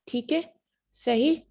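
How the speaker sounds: normal talking voice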